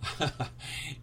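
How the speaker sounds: short breathy laugh at himself; breathy embarrassed laugh. Real, not acted. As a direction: laugh it off, sheepish. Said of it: "My Japanese is failing".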